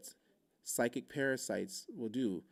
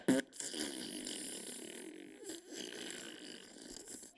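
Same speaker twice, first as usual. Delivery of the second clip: long comic hiss of escaping air; drawn-out mouth-made deflation effect; imitated deflating noise; 6 dB quieter than usual. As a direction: blow out like a slowly emptying balloon.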